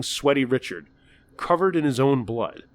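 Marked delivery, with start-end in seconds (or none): none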